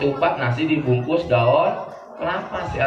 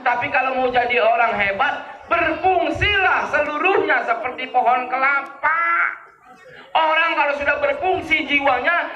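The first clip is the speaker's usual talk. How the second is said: raised, high-pitched sermon voice; preaching; 11 semitones higher than usual. Acted. Preacher driving home the coconut lesson to listeners, raised preaching. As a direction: preach the moral higher than the story.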